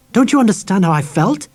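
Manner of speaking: high, loud wounded demand; angry, hurt woman. A narrator's reading. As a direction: plead in hurt anger. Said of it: "The woman's voice was sharp and angry".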